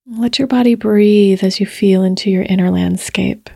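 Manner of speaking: unhurried meditation voice with long pauses; guided meditation. Real, not acted. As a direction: gently guide listeners into their bodies, leaving space between phrases.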